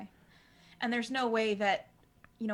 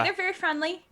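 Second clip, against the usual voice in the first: higher, chirpy reassurance; high defensive voice; 8 semitones higher, 4 dB louder than usual. Acted. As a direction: vouch for your weird friends. Player defends caretakers.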